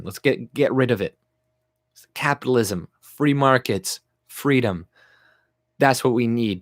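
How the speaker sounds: usual speaking voice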